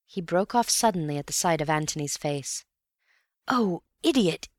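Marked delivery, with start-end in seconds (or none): 3.5-4.5 sudden self-scolding cry, rising to a loud, high shout. Acted. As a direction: kick yourself out loud, the second idiot shouted. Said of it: "Antony cried".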